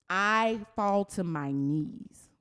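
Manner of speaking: very slow, weighted words; dramatic slowdown. Real, not acted. [name] telling the moment she fell, very slowly. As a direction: let each word land slowly.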